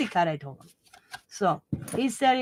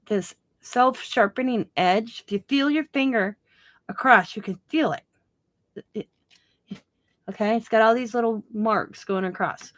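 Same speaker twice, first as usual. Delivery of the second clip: ordinary talk, nothing marked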